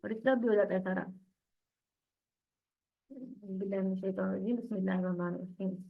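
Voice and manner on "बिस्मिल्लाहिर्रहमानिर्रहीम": soft, low recitation; recited invocation. Real, not acted. Teacher softly recites before class. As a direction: softly intone the opening blessing. Opens the Quran lesson.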